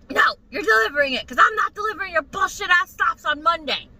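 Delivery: heated mock yell; retold angry yelling. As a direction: imitate him laying down the law. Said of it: "he's still screaming and yelling".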